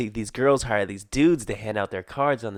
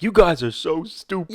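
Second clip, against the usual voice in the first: exasperated dad; 7 semitones higher than usual. Retold or imitated. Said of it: "your dad was like".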